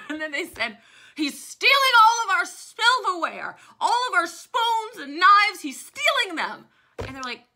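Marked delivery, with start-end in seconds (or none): 1.1-6.7 loud, much higher, urgent complaint; loud high indignant tattling; indignant accusation. Retold or imitated. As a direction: cry it out like a wronged lady. Women accusing Butler, voiced.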